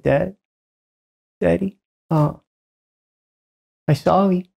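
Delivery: a hint of a little girl saying sorry; toddler apology. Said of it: "so adorably".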